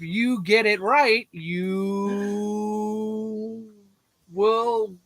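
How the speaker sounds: slow, suspenseful build-up with held words; drawn out. Real, not acted. Host teasing viewers who guess the bunny names, drawn out. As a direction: stretch YOU out long and level, then pause.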